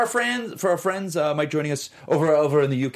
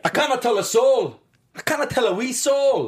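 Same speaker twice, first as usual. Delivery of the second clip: higher, emphatic mock Scottish; Scottish accent; 6 semitones higher than usual. Cohost jokes about secrecy, in mock Scottish accent. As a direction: protest in a hammed-up Scottish burr. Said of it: "I've never done Scottish before".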